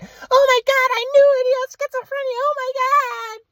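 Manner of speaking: mocking high voice of a frantic mom; mom freaking out. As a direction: shriek it like a mother losing it. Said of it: "And she's freaking out".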